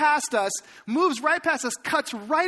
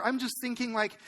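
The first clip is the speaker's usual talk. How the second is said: ordinary talk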